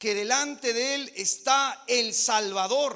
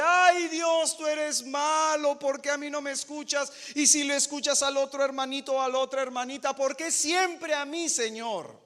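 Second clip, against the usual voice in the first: whiny complaint; 5 semitones higher, 4 dB louder than usual. Retold or imitated. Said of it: "cuando clamas y Dios no te responde".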